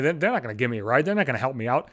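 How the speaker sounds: ordinary talk, nothing marked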